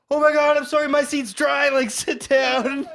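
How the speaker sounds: high, flustered, rushed apology; frantic apology. Retold or imitated. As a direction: blurt it out all flustered. Frantic apology offering seat.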